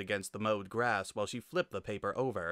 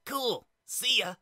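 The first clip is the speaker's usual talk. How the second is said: put-on high, slow, eager kid; excited kid; 13 semitones higher, 4 dB louder, slower than usual. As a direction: shout a happy goodbye. Monster Kid cheerful farewell.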